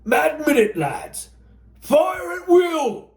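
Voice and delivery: high, slow drawn-out fire order; commanding shout. Acted. Sergeant ordering his riflemen to open fire, high slow shout.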